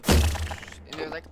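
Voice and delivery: ordinary talk, nothing marked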